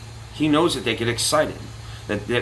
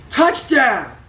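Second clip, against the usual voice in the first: shouted celebration; 12 semitones higher, 5 dB louder than usual. Retold or imitated. Fan celebrating a score.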